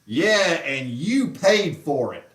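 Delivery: slow, loud, drawn-out gloating; mock smug boast. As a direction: imitate him gloating at the camera. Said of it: "he turned and looked at the camera and said".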